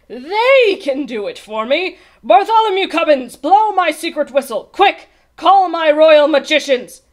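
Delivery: loud, high, triumphant royal orders; excited command. A narrator's reading. King summoning his magicians, excited shouting. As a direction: call out the orders with urgent glee.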